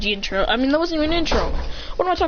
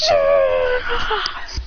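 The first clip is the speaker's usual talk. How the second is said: high yell; 8 semitones higher than usual. Acted. Hero yells during attack.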